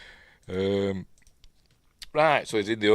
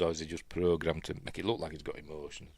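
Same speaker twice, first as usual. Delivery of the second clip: ordinary talk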